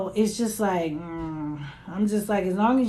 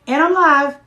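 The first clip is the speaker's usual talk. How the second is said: exasperated order; 5 semitones higher, 6 dB louder than usual. Real, not acted. Mom orders son to fetch charger.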